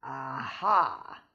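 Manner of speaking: low, loud gloating aha; fox's triumph. Acted. Fox gloats over the caught goose, low and loud. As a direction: crow over the catch. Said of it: "went the fox".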